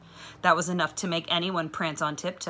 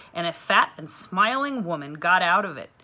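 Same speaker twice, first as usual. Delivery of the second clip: usual speaking voice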